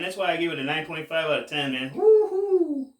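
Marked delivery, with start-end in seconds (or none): 1.9-2.9 high, loud whoop of delight; real whoop after the hit. Real, not acted. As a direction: let out a big happy cheer.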